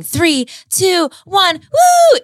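high loud pretend countdown cheer ending in a whoop. Picturing New Year in London, cheering the countdown. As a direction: shout the countdown like at midnight.

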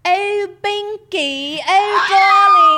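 very high, drawn-out, loud posh English wail; hammed-up aristocratic British caricature; obnoxiously posh British accent. Shown as a demonstration. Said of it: "obnoxiously posh".

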